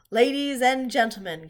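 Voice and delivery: announcer opening his address; loudspeaker announcement. A narrator's reading. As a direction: boom it out over the fairground. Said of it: "continued the loudspeaker".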